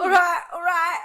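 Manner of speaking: high, loud squeaky laughing voice. Real, not acted. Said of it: "Me and my squeaky fucking voice".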